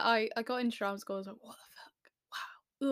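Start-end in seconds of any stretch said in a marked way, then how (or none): 1.4-2.9 slow, hushed disbelief; stunned whisper. Retold or imitated. Whispering in disbelief at getting into drama school. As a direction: let the shock drain the voice.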